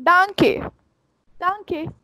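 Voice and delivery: danke said as dahn-keh, with an open a; correct pronunciation. Shown as a demonstration. Teacher correcting learners who say donkey, careful. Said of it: "It's not donkey".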